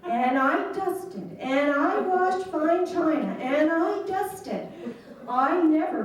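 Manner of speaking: slow, high, drawn-out list of chores, laughing at the end; milk the tedium of dusting, half laughing; mock weary complaint. Daughter recalling her dull store chores, mock weary and slow.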